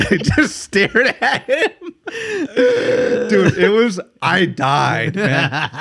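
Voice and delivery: high, helpless real laughter through words; laughing. Real, not acted. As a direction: struggle to talk while cracking up. Cracking up at own story.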